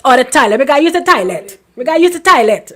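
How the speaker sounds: loud, thick Guyanese accent, imitating younger self. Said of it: "We had guy in an accent".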